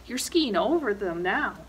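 ordinary delivery, nothing marked

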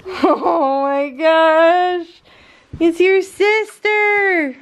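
slow, high, drawn-out coo; melting over the two dogs; awed gush. Real, not acted. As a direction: stretch every word in delight.

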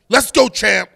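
high, loud shout of his catchphrase. Retold or imitated. Boxer sneaks up behind David at a press conference and yells, full shout. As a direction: yell it right behind his back to make him jump. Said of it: "I came behind him. I said".

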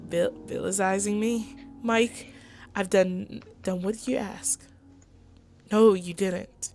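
normal talking voice

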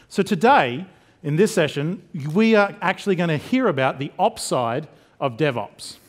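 ordinary delivery

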